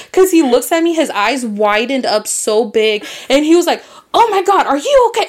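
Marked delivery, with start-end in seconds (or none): 4.1-5.3 high, louder alarmed question; retold panic, pitched right up; panicked. Driver who hit the child on the bike, retold in high panic. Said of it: "he starts panicking".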